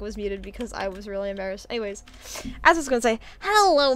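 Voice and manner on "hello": bright squeaky anime greeting; high cute anime girl. Acted. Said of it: "Anime voice is back".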